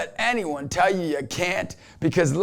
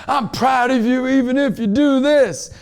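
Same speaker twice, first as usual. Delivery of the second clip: preacher mode; 9 semitones higher, 6 dB louder than usual. Retold or imitated. New dad addressing his baby, in loud preacher mode. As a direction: proclaim it as if to a packed event. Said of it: "so I went into preacher mode".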